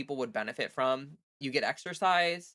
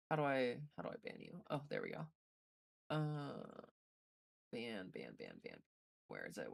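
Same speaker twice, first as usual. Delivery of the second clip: under the breath, to self; quiet muttering; 11 dB quieter than usual. Real, not acted. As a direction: mumble it quietly while distracted. Muttering to self while banning.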